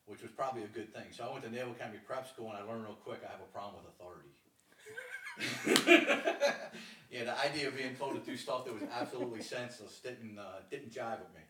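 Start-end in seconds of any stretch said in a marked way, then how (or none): 4.7-7.0 loud airy burst of laughter; big laugh. Real, not acted. Laughing at his own problem with authority, a loud high laugh.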